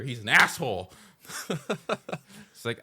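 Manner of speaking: ordinary talk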